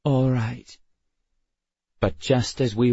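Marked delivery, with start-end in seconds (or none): 0.0-0.8 heavy, resigned. A narrator's reading. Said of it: "she said heavily".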